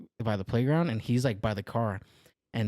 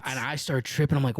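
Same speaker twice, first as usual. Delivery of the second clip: ordinary delivery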